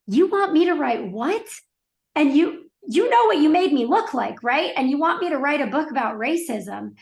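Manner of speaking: re-enacted disbelieving protest; incredulous. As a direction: question it in disbelief, voice lifted. Acts out questioning God on plane.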